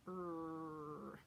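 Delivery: long, low "Er" with a hard R; Midwestern R. Shown as a demonstration. Teacher demonstrates a Midwestern sound, hard R. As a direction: draw out "Er" in a growly Midwestern R. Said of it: "This is a very Midwestern R".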